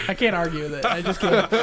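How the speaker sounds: amused surrender, words shaken by laughter; laughing helplessly. Real, not acted. Game master concedes to the persuasion roll, laughing. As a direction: give in while laughing. Giving in after high dice roll.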